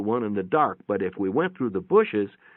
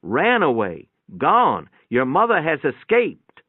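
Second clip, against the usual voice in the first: higher, louder, the word gone leaned on hard; nurse insisting; 4 semitones higher, 6 dB louder, slower than usual. A narrator's reading. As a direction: hammer home that she ran off. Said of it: "the nurse said".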